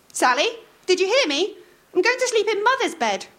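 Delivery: mother calling to hidden daughter. A narrator's reading. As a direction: call through the wardrobe door. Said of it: "called Maud".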